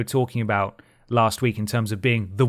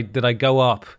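normal talking voice

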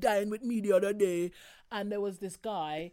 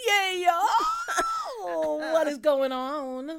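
loud, high whoop into a long drawn-out greeting; hyped show opener; 8 semitones higher, 6 dB louder, much slower than usual. Acted. Host opening the podcast episode, loud excited greeting. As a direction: holler it to hype up listeners.

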